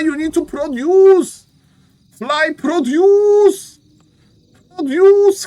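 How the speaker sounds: high, slow, loud demand; loud urging. Real, not acted. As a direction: shout the order up high and slow. Urging a player loudly.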